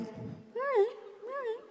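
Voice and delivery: tiny, high, soft voice; hushed kids. Retold or imitated. Kids promising silence for fortunes, tiny hushed agreement. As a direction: squeak agreement very quietly.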